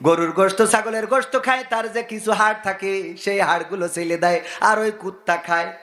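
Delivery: chanting sermon with sudden high-pitched surges; singsong preaching. Acted. Preacher to congregation on animals obeying man, singsong exhortation. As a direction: preach in sustained tones, stretching the phrase endings.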